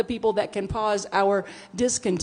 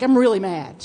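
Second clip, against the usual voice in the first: mad; 8 dB louder than usual. Retold or imitated. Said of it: "And I'm like".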